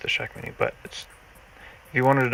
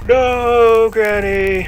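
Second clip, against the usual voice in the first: high, loud alarmed cry; shouting; 12 semitones higher, 15 dB louder, much slower than usual. Acted. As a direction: yell out as she's carried off. Granny kidnapped, acted cry.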